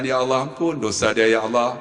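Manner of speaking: low, monotone praying voice; prayer. Retold or imitated. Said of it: "saya doa". (suara berdoa yang rendah)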